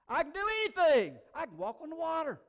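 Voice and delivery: loud, high boasting; shouted bravado; cocky Peter. Acted. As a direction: crow it with overblown confidence. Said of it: "you know what Peter thinks".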